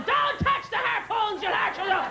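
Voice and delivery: high, slow mock yell; hammed-up panicked dad shout; screaming father. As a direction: imitate a dad yelling a warning. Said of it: "My father's screaming".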